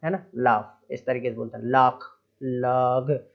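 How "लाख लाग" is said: "लाख" and "लाग" with the gh sounded as kh and g; misreading laugh; wrong pronunciation. Shown as a demonstration. Teacher showing how not to say laugh. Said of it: "ऐसे नहीं बोलना है".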